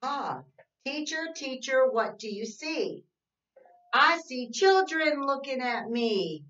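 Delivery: sing-song read-aloud, slow and even; reading to children. Acted. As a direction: read the rhyme to little kids, slowly. Reads picture book.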